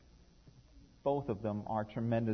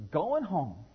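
put-on cheerful child; 5 semitones higher, 4 dB louder than usual. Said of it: "said Freddie cheerfully".

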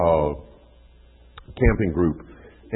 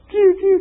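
much higher, pew-pew game noises; arcade game zaps; 9 dB louder than usual. Retold or imitated. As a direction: zap like a kid lost in a video game. Kid keeps playing the game.

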